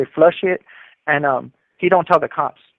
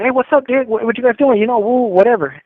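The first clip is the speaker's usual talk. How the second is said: lightly acted homie greeting, pitched up; breezy higher-pitched hello, imitated; casual greeting; 7 semitones higher, 3 dB louder than usual. Older homie greets two chess players, casual higher-pitched imitation.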